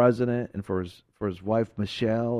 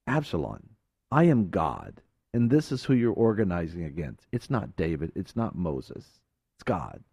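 lower, softer divine voice sinking to a low hush; voice of God fading; 7 semitones lower, 4 dB quieter than usual. Acted. God warns Absalom, fading to a low hush. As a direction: let it sink lower and softer to the end.

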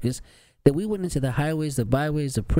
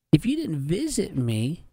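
rising plea; 9 semitones higher than usual. Retold or imitated. Quoting Jesus on judgment.

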